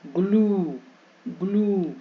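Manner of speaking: ग्लू with a held "glooo"; long oo vowel. Shown as a demonstration. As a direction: draw out the oo in each ग्लू. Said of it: "बड़ी यू ई".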